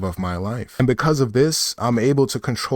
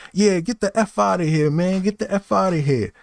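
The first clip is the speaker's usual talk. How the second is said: higher voice, shooing him out; clerk telling him off; 7 semitones higher than usual. Retold or imitated. Clerk sending the rude guy away, higher dismissive voice. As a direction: wave him off, pitched up. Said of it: "he was like".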